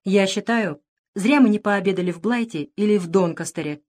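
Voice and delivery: ordinary delivery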